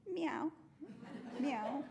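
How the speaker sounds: high, short kitty meows; cat meowing. A narrator's reading. Cat shows the rooster how to crow, high meowing. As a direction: meow high, with a pause between. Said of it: "looked up at the sky and said".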